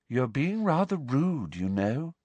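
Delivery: slow, dreamy, gentle voice; unhurried, placid remark; serene. A narrator's reading. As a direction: observe it serenely, without offence. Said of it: "said Luna serenely".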